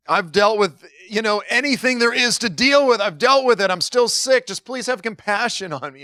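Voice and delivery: high-pitched, exasperated pleading; fed-up plea. Retold or imitated. Long-sick person begging advisers for compassion, exasperated. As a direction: plead as someone at the end of their rope.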